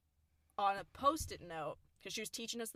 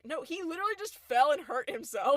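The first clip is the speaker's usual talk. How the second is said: loud, raised-pitch retold objection; insistent protest; 8 semitones higher, 10 dB louder than usual. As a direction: argue back emphatically at mom. Retelling argument with mom.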